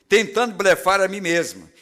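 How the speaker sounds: ordinary talk, nothing marked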